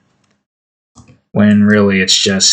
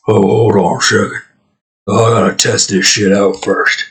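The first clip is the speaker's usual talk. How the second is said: bear voice; 3 semitones lower than usual. Acted. Bear testing its coke, lower voice. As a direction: lower voice, a stoned bear checking his stash. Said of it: "The bear's like".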